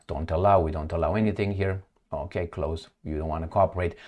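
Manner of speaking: low, soft aside to the computer; muttering to himself. Real, not acted. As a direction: grumble quietly at an uncooperative screen. Grumbling at the page he operates.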